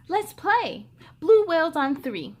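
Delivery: eager rally. A narrator's reading. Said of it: "she said".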